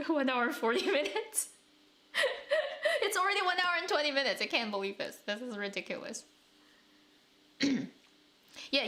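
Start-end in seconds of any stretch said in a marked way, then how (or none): 0.1-4.4 high, fast, still laughing; laughing disbelief. Real, not acted. To chat about the stream running long, laughing disbelief. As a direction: blurt the time out through a laugh.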